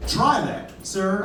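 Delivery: ordinary talk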